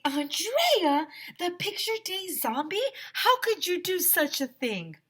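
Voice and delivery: put-on high, scandalised girl voice; shocked girl. Emily accusing Andrea in shock. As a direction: gasp out the accusation.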